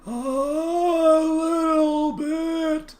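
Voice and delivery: drawn-out high river spirit; slow booming river voice. Acted. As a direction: speak as an ancient river, stretched slow.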